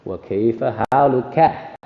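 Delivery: haluka slow with the final ka clear; carefully voweled wa kayfa haluka; slow full ending. Shown as a demonstration. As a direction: drag out the ka of haluka. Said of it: "we see the ka".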